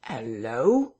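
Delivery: low start swooping up into a questioning hello; startled query. A narrator's reading. Teddy Henfrey reacts to a thud behind the parlour door, startled query. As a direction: call out a puzzled hello.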